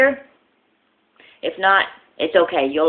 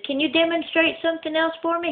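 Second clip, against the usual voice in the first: woman's voice; 13 semitones higher than usual. Acted. Blonde woman voiced in joke.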